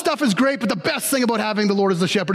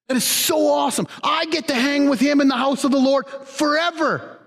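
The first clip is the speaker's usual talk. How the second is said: ordinary talk, nothing marked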